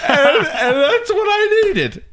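high, stretched-out comic wail; hammed-up defensive cry; mock-impassioned. Comedian justifying a day of me time, mock-impassioned.

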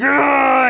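growl-shout like a big wrestler showing off; ogre-man roar. Acted. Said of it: "he's like".

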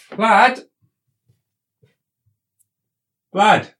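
calling up the stairs. Acted. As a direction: call his name across the house.

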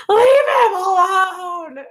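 high, slow desperate shriek; frantic screaming. Retold or imitated. Mother shrieking at the ghost to protect her son. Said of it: "and she's like".

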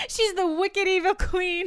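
drawn-out, high-pitched exaggerated line; mock-dramatic echo. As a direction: ham it up high and slow. Mocking echo, hammed-up line.

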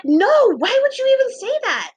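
loud, very high indignant cry; offended outburst. Retold or imitated. Girl answering the boyfriend question, loudly offended. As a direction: burst out in affronted disbelief. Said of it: "I got so offended".

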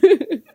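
high loud laugh breaking through; burst of laughter. Real, not acted. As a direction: crack up mid-sentence. Laughs mid ad parody.

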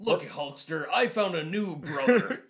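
laughing, raspy wrestler promo; Macho Man impression. Quoting Macho Man to Hulk Hogan.

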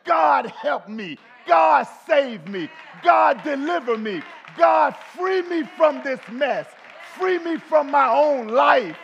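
screaming prayer for deliverance; desperate cry to God. Acted. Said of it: "when you cry from a heart".

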